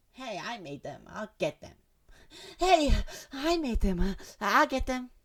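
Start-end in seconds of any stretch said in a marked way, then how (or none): 2.6-5.1 much higher, slow, gushing; overexcited cute girl squeal; too excited. Acted. Voicing Natsuki claiming her cupcakes, overexcited and high. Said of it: "Sorry, a little too excited".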